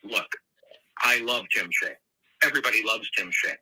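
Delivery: usual speaking voice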